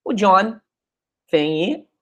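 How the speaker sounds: ordinary delivery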